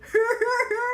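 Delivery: high mock giggle; imitating her laugh. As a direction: copy his laugh, high and hammy. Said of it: "I can laugh like you".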